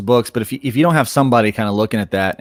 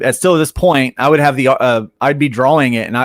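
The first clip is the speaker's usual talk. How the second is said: usual speaking voice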